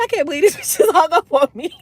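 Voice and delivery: fast, laughing through disbelief; to the camera, laughing at being hung up on. Real, not acted. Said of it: "I laughed".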